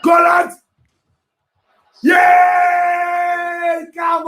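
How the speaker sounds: very high, loud ecstatic shouting; real cheering. Real, not acted. Fan cheering England's goal at the TV, ecstatic high shouting. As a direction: scream it like the goal just went in.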